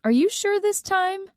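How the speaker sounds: teasing father. A narrator's reading. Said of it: "My father laughs and says".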